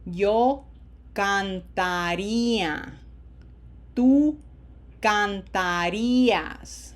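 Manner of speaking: "cantaría" and "cantarías" slowly, each A a full, open "ah"; open, unshortened A's. Shown as a demonstration. Teacher drills verb forms with learners, slow open vowels. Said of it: "we don't wanna shorten them".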